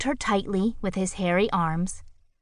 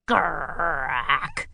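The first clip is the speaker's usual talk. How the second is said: grumbled; 2 semitones lower than usual. A narrator's reading. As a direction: grumble it sulkily. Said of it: "grumbled Grackle".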